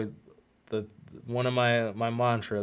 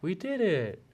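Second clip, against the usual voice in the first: put-on shy little boy; 8 semitones higher than usual. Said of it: "kinda shy".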